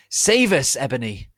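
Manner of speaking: loud, high plea for rescue; desperate cry. Acted. Dumbledore begging Ebony to save them, loud desperate cry. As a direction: cry out for help. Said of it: "Dumbledore cried".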